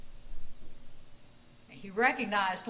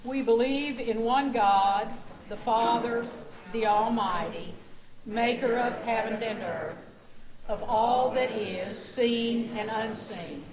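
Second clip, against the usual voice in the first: louder, measured communal recitation; reciting the creed; 3 dB louder than usual. Acted. Pastor leading the congregation in the creed, loud and steady. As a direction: recite it firmly with the congregation, slowing at the end.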